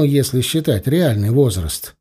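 ordinary delivery

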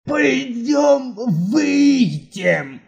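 very slow, strained frightened whimper. Acted. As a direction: squeeze it out in fear. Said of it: "полупердёжный натужный всхлип".

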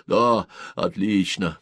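ordinary delivery, nothing marked

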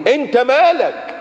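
high, slow scolding; stretched-out reproach; indignant rebuke. Acted. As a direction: put the busybody in place. Scolding the nosy questioner.

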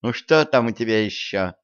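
ordinary delivery, nothing marked

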